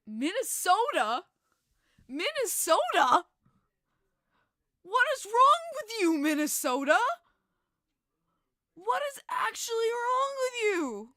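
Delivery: high, loud, near-crying disbelief; incredulous. Real, not acted. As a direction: plead with the whole state. Exasperated at Minnesota.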